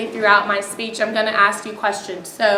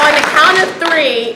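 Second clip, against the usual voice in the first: raised, carrying gym-coach call; 5 semitones higher, 7 dB louder than usual. Real, not acted. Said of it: "I just have to use my coach voice today".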